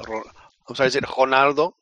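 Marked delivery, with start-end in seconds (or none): 1.1-1.7 trying Ronaldo with an H sound, Brazilian style; imitated Brazilian accent. Co-host checks the pronunciation, imitated Brazilian accent. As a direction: echo the Brazilian "Honaldo", questioningly. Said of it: "as Brazilian as possible".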